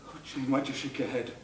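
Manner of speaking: normal talking voice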